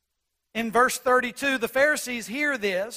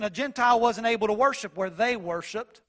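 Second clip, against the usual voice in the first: normal talking voice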